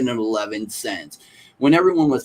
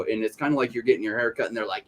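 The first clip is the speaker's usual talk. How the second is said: ordinary talk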